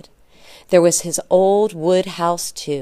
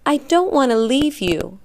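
put-on higher, pleading child's protest; upset cry; 7 semitones higher, 4 dB louder than usual. A narrator's reading. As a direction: cry it out, not wanting to go. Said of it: "cried Janek".

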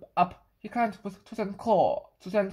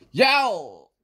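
loud, odd-voiced yowl; cat yowl; 7 dB louder than usual. The hungry cat finding the fish gone, loud yowl. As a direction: yowl in frustration. Cat finds table empty.

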